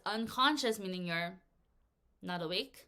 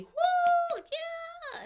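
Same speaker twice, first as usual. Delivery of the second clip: high-pitched cheer "Woo, yeah"; 7 dB louder than usual. Shown as a demonstration. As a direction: whoop like a cheering crowd. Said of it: "A regular ovation would just be".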